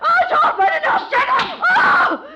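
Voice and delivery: high, loud panicked shriek; wife shrieking during the struggle; hysterical screaming. Acted. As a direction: fight him off screaming. Struggle over burning money.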